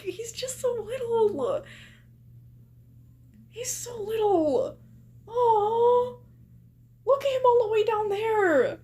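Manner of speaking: squeaky aww-voice, melting at how little he is. Real, not acted. Streamer to the baby picture, high cooing baby talk.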